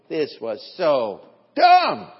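loud, stretched burst on DUMB; mock outburst at your own stupidity; self-reproach. Driver scolds himself after being pulled over, mock exasperated outburst. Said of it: "you're just sitting there and you're going".

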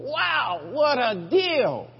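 high, slow, drawn-out exclamation; retold outburst at a scoundrel; mock amazement. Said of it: "I'm sitting there going".

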